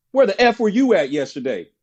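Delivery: mock angry coach snapping at him; gruff coach. Coach confronting the no-show player, mock angry. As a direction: coach barking where were you.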